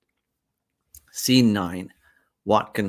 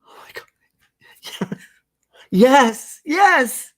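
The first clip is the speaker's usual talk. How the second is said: gasp, then high, loud yes; overjoyed yes; 7 dB louder, much slower than usual. Acted. Bride accepting the proposal with joyful shrieks. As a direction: whisper in shock then burst out yes. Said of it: "He puts the ring on".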